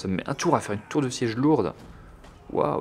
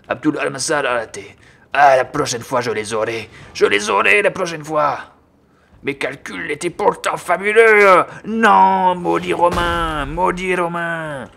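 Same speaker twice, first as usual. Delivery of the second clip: high, loud melodramatic lament; thwarted villain general; 7 semitones higher, 8 dB louder, slower than usual. Pretend. General cursing the Roman after missing, hammed-up despair. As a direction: build to a drawn-out comic curse.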